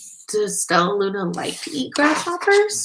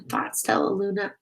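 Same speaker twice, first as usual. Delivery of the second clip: ordinary delivery, nothing marked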